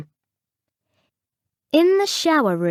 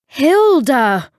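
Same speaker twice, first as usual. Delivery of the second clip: loud, high, shocked gasp; 5 semitones higher, 6 dB louder than usual. A narrator's reading. Coach seeing the tea lady appear, gasped. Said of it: "gasped Ray".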